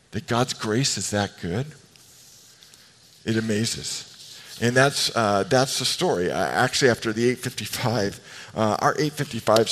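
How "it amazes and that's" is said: ordinary talk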